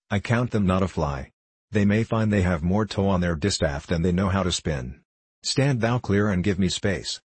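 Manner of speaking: ordinary delivery, nothing marked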